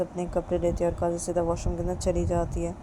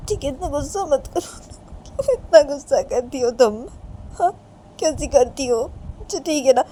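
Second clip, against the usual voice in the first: high, loud, upset insistence; tearful protest; 7 semitones higher, 7 dB louder than usual. Acted. Reshma tells angry Kajal she is not crying, tearful protest. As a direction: insist through tears, pitched up.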